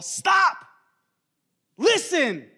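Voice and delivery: high, loud command, cutting him off; frustrated shout. Acted. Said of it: "Just imagine the frustration right now".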